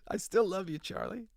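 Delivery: mock-pained high-pitched assurance; pained reassurance. Mock-pained reassurance to absent actor.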